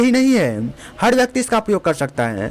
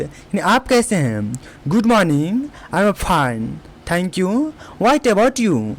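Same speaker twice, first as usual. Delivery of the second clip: usual speaking voice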